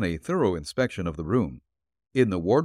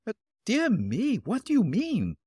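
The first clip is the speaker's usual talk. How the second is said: put-on higher, slower, alarmed old lady; apprehensive exclaim; 13 semitones higher than usual. Landlady alarmed by the detective's questions, apprehensive. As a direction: gasp it out in worried alarm. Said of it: "exclaimed apprehensively".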